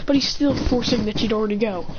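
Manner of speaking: ordinary talk, nothing marked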